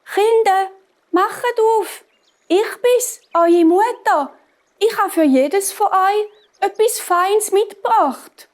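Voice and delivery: high, dainty fake-mother voice; wolf coaxing in a high, thin disguise; fine-voiced wolf. A narrator's reading. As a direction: pitch it way up to pass as mother. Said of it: "hat er ganz eine feine Stimme bekommen".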